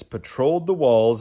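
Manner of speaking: ordinary delivery, nothing marked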